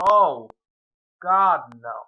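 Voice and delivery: loud, sudden "oh God, no"; dismayed outburst. Real, not acted. Tutor notices an error on his board, loud dismayed cry. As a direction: blurt it out in real dismay.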